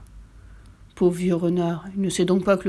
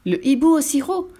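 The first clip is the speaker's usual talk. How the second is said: higher, louder flustered owl; hurried fright; 8 semitones higher, 4 dB louder than usual. A narrator's reading. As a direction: rush the goodbye in fright. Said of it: "dit le hibou précipitamment".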